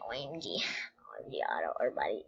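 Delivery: soft unvoiced whisper. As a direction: breathe the words out. Whispering while trolling players.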